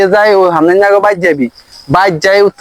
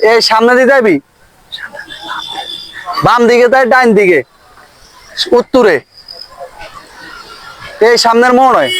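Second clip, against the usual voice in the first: full-voice phrases broken by near-silent whispers; comically over-literal switching of volume; alternately loud, hushed. Acted. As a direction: swing between speaking up and whispering. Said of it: "একটু জোরে, একটু আস্তে কই".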